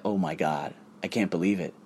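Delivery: low, soft amazement; hushed disbelief. Retold or imitated. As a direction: say it under your breath, stunned. Retold reaction to a message.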